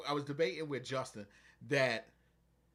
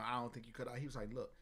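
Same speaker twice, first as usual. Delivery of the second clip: low, much softer, steady mentor voice; low calm mentor; 6 semitones lower, 9 dB quieter than usual. Retold or imitated. Veteran telling the rookie to watch, low and soft. Voicing Hal low and quiet.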